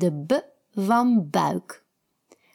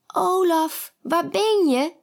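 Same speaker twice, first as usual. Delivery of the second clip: calling out; 10 semitones higher than usual. A narrator's reading. Kiki calls her friend.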